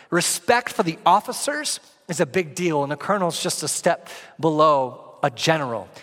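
ordinary talk

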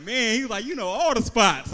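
mock amazed cousin. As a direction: marvel at all the malls.

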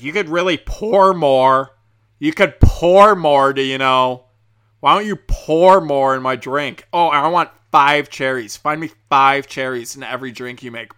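loud, drawn-out impression of a pushy drunk customer; loud mock customer demands. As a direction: belt out her demands slowly, whining and over the top. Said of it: "that was probably super loud".

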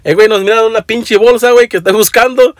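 high, fast, urgent chatter; hurried Spanish. Retold or imitated. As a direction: rattle it off in a rush. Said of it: "and then Speedy".

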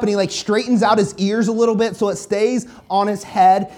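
ordinary talk, nothing marked

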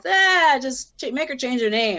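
high, loud "Ah", then a dismissive mock order. Rapper feeling like a puppet, mocking those pulling the strings. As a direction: imitate careless handlers waving her off.

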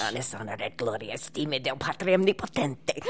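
fast, solemn priest's Latin; rapid ritual chant of an exorcist; Latin exorcism incantation. Shown as a demonstration. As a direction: intone it like an old Italian priest casting out a demon. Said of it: "So I gave him the speech I had to give".

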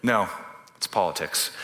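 ordinary delivery

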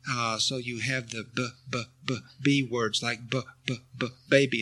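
put-on b, b, b, baby sounded out letter by letter; sounding out words. Shown as a demonstration. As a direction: stutter the b sound before baby. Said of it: "you sound out each word".

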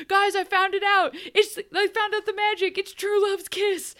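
high, loud mock Australian Belle; imitating Belle with an Australian accent; heavy Australian accent. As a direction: gush the discovery in a hammed-up Australian accent. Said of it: "she does Belle at the end of it with this really heavy Australian accent".

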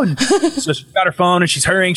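ordinary talk

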